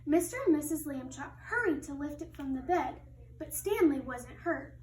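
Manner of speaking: ordinary talk, nothing marked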